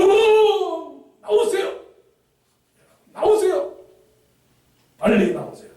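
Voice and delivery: high, loud shout to get out; urgent rescue call. Acted. Preacher voicing a rescuer calling people off the sinking ferry. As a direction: call them out of the ship.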